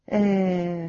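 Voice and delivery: ordinary delivery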